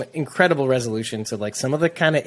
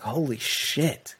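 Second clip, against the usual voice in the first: slow, quiet awed curse; stunned whisper; 6 dB quieter, much slower than usual. Retold or imitated. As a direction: breathe it out slowly in shock. Stunned reaction retold.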